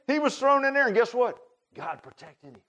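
ordinary talk, nothing marked

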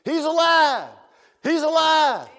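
high, loud, jubilant cry; joyful shout. Pretend. Imagined believer shouts the news to everyone, jubilant high loud cry.

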